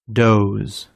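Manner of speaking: careful word-list model, each word set apart by long pauses; pronunciation drill. Shown as a demonstration. Teacher giving learners words to repeat, careful low falling drill voice.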